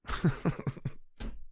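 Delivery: high breathless giggle; wheezing high giggle; real laughter. Real, not acted. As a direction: laugh helplessly, almost silent. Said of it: "I'm laughing".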